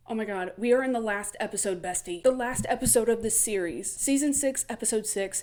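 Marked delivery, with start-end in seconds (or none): none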